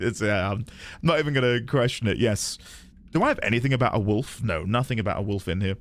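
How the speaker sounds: usual speaking voice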